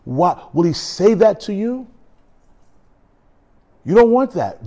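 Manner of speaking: ordinary talk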